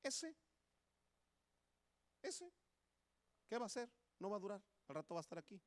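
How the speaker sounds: high, scoffing mock voice, then the rest rattled off fast; mocking townspeople. Hometown people doubting the migrant, high mocking sneer then a fast rattle. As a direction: sneer like doubting neighbours, then rush the end. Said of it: "la gente decía".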